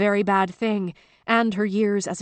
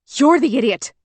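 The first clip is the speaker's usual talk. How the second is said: loud, sharp retort; loud angry snap; snapping back; 5 semitones higher, 7 dB louder than usual. A narrator's reading. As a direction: throw the insult right back. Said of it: "Virginia snapped back".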